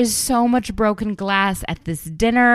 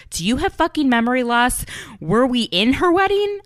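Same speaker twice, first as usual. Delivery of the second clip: higher, mildly heated retelling; a touch of TV-housewife indignation; mock annoyed outburst; 7 semitones higher than usual. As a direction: imitate an exasperated friend. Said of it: "visibly upset and annoyed".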